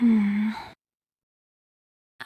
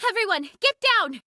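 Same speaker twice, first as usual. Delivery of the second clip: higher, urgent shout to the squad; urgent call; 8 semitones higher than usual. Acted. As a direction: warn everyone to drop now. Sudden enemy attack warning.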